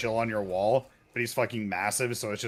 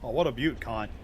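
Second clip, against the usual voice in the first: put-on Australian accent. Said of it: "throwing Kant at the end".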